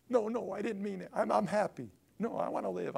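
high, hurried pleading; frantic backtracking. Acted. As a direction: take it all back in a high plea. Takes back complaints to God.

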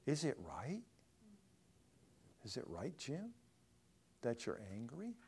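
soft, near-whispered questioning; small, still voice. Acted. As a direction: ask gently, barely above a whisper. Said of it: "small, still voice saying".

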